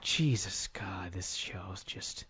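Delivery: low, very soft, fading to a near-whisper; slow exasperated groan of words, trailing into breath; weary disgust. Real, not acted. Reviewer despairing over the SmackDown show, weary and hushed.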